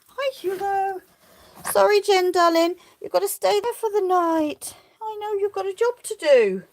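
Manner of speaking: high sing-song voice for the sheep; pet talk. Real, not acted. Talking to the sheep.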